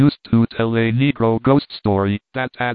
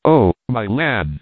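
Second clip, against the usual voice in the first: slow trembling gasp; shivering fright. Acted. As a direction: shiver out the words in terror. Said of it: "begin to shiver and shake".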